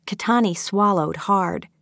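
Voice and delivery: usual speaking voice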